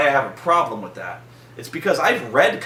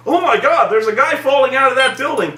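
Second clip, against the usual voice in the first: high, loud, fast fake alarm; mocking a Superman who should care, high and fast; mock concerned Superman; 9 semitones higher, 4 dB louder than usual. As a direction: rattle off hammed-up worry.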